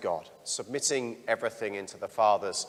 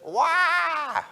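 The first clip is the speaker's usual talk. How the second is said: put-on high wailing baby "wah"; a mock infant cry, high and loud; imitated baby cry; 6 dB louder than usual. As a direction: wail it like a newborn. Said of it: "the first words that we cry out".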